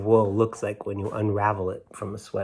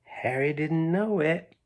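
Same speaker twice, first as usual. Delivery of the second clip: hushed storybook narration sinking to a whisper; reading to children; 2 semitones higher than usual. Acted. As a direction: draw the kids in as the suspense builds. Reads the story aloud to children.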